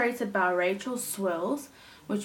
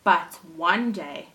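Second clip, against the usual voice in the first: put-on lively storytelling for children; animated read-aloud for little listeners; story narration; 4 dB louder than usual. A narrator's reading. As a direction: read it like a picture book to a class. Teacher reads story aloud.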